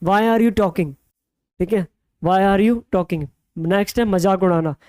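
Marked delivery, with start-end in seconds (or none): none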